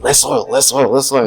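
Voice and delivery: loud, high repeated demand; exasperated. Retold or imitated. Repeated plea to masseuse, exasperated.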